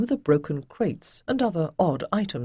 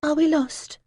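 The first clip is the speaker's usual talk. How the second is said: put-on higher, anxious young voice; frightened girl; 11 semitones higher than usual. A narrator's reading. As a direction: ask it holding back fear. Ariel frightened on the sea.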